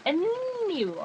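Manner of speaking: long, high swooping 'anyway'; playful drawn-out topic change; singsong anyway. Real, not acted. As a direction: stretch it into a high arch that falls away.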